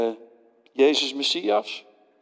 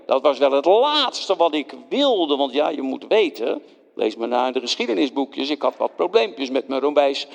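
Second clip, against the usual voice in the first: usual speaking voice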